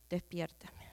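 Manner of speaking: whispered plea. Retold or imitated. Pleading prayer, whispered.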